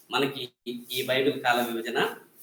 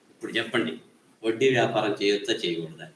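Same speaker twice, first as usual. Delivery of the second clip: normal talking voice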